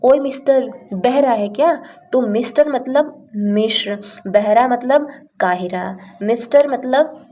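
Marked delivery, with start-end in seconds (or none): 0.0-1.8 higher, rude taunt; with attitude. Shown as a demonstration. Stubborn person won't make way, rude and higher. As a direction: taunt him for not hearing. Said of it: "ऐसे बोलते हैं ना".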